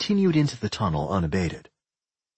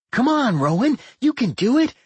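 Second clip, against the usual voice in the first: high, louder cheerleading voice; encouraging; 14 semitones higher, 5 dB louder than usual. A narrator's reading. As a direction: egg them on brightly, pitched high. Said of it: "Doc said encouragingly".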